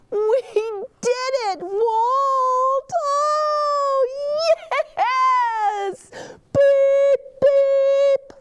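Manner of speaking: high, loud triumphant cry; joyful cheer. A narrator's reading. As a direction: shout with pure joy at the win. Celebrating success.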